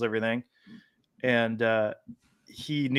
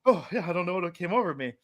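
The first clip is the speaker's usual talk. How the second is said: sheepish; 6 semitones higher, 3 dB quieter, faster than usual. Retold or imitated. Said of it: "he was just like".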